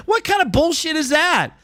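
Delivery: real exasperated rant; heated rant. Real, not acted. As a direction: rant at chat like they said something absurd. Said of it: "the old man dropping the F-bomb".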